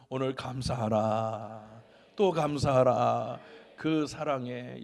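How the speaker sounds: slow, drawn-out proclamation; solemn exhortation. Real, not acted. Preacher urging congregation to give thanks, slow proclamation. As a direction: stretch each phrase for the congregation.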